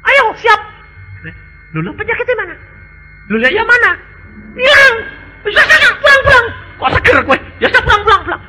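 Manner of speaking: high, lively comic voices; animated retold dialogue. Preacher retelling the doctor and healed patient's amazement, high and lively. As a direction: act out the surprised doctor and patient. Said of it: "retold hospital dialogue voices".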